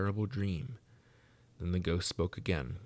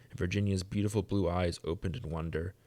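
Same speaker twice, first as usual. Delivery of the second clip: plain reading voice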